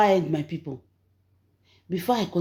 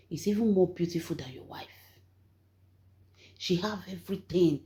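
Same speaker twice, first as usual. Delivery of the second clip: whispered aside; 5 dB quieter than usual. Real, not acted. Confiding aside to viewers.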